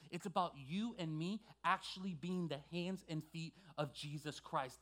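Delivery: usual speaking voice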